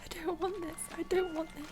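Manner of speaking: ordinary delivery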